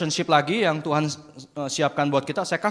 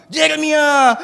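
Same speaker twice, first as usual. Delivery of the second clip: put-on loud, raised-pitch call to his child; father calling out; 10 semitones higher, 9 dB louder, much slower than usual. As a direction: shout the boy's name as dad. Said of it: "Papa langsung bilang".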